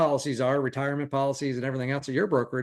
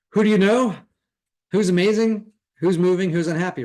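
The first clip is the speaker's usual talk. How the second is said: loud coaching questions; 5 semitones higher, 5 dB louder than usual. Real, not acted. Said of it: "coaching questions to ask partners".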